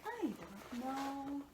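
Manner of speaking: soft, very slow drawn-out "I don't know"; lazy slow "I don't know" under the breath; imitating the car guy. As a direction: drawl it out quietly, mock puzzled. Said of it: "I'm just like".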